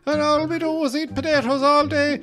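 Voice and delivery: very high, loud stage-Irish rant; hammed-up Irish outburst, building to an indignant yell; ranting Irish character. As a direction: rave in an over-the-top brogue. Spoof trailer builds into Irish rant.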